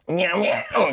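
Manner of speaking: high imitation meowing; cat meow.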